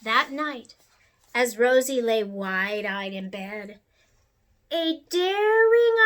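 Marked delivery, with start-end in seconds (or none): none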